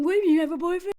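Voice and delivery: mock wounded surprise, rising on boyfriend. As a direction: play the poor victim, shocked. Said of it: "okay, so now he's the victim".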